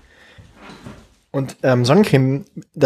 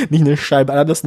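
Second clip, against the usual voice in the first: ordinary talk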